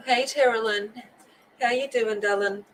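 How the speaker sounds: ordinary talk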